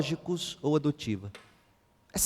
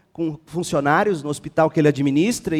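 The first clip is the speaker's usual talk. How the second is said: ordinary talk, nothing marked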